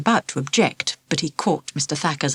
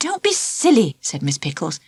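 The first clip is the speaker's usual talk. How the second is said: brisk teacher; 7 semitones higher than usual. Acted. Teacher scolds, urging hurry.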